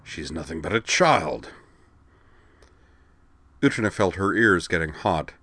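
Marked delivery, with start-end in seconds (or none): 0.0-1.5 slow, dismissive rumbling growl; rumbling Klingon. Acted. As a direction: rumble it out with contempt. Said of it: "Zarg rumbled".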